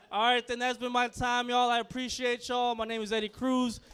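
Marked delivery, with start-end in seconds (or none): none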